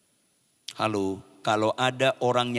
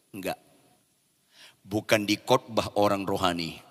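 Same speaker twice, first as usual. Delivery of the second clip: ordinary delivery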